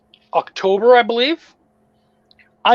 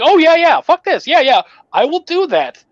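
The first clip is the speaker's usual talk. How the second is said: mock eager agreement; 9 semitones higher, 4 dB louder than usual. Sarcastic reply to his friend.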